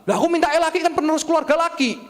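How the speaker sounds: high, loud petulant complaint; hammed-up grumbling parent; mock indignant parent. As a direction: whine it high like a father wanting a son. Voicing a disappointed parent.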